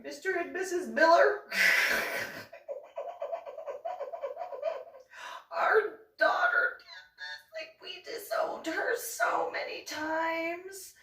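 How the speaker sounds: a shrill fake-sweet parody to the neighbours; mocking Anna. Anna disowning her daughter to the Millers, high mock plea. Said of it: "going over to the Millers and be like".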